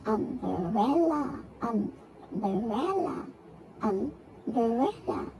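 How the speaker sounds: "Umbrella" very slow, in three beats; syllable by syllable. Shown as a demonstration. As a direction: draw out each syllable of "Umbrella". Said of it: "three syllables".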